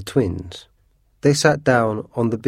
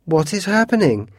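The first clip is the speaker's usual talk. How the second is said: high-pitched, bewildered question; startled cry; 9 semitones higher than usual. A narrator's reading. Mrs Otis sees a hand poke out, crying out. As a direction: voice shoots up in alarm. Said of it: "cried Missus Otis".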